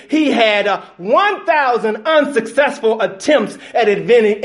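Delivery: loud, high, fervent preaching to the church; full-force preaching. Acted. Pastor rallying his congregation about failure, loud fervent preaching.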